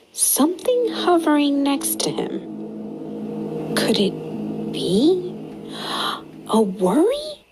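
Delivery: slow, secretive whisper; hushed whisper. A narrator's reading. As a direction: draw the children in with a slow hush. Mysterious discovery at the park.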